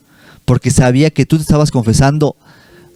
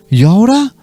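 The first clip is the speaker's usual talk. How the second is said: high, rising puzzled question; taken aback; 8 semitones higher than usual. Retold or imitated. Businessman startled by the priest's yelling, imitated bewilderment. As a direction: imitate someone bewildered at being yelled at. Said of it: "se queda así como que".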